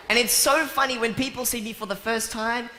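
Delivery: ordinary talk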